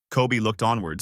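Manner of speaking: plain reading voice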